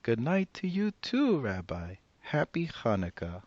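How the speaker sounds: put-on high, bright old granny greeting a guest; old woman voice. Old woman welcoming the rabbi at her door, high and cheery. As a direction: play the cheerful old grandmother.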